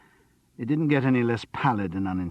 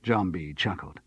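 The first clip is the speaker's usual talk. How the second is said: usual speaking voice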